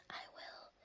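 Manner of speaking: barely audible whispered "I will"; hushed, voiceless whisper. A narrator's reading. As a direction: whisper it as an obedient child. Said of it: "Pig Will whispers".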